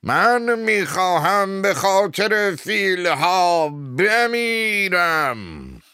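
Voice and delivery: very high, very loud, drawn-out cry; shouting. Acted. Abe Fields declaring he will die for the elephants, screaming. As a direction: scream it out, stretching the last word. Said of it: "ایب فیلدز فریاد زد".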